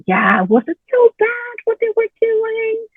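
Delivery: a high, light voice of doubt; inner avoider voice. Retold or imitated. Her inner avoider questioning her decision, a high lightly voiced doubt. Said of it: "my avoider said".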